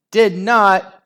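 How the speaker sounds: high, loud denial; shriek. A narrator's reading. Henry denying he tripped Margaret, shrieking. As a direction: shriek it straight back. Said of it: "shrieked Henry".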